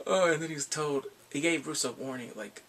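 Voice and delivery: usual speaking voice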